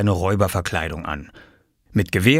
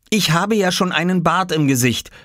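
put-on higher-pitched boy's retort; objecting child; 10 semitones higher than usual. Boy refuses to be princess, childish retort. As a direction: argue back in a lifted child voice. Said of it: "erwiderte Oskar".

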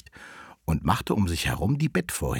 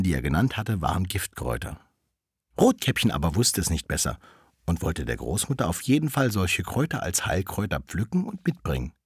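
ordinary delivery, nothing marked

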